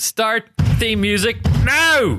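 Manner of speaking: slow, high Donegal shout at the machine. Acted. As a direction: bellow the command word by word. Shouting at the voice assistant.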